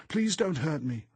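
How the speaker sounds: high, loud tearful pleading; distressed, wailing plea; sobbing. A narrator's reading. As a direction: beg desperately, wailing like a child.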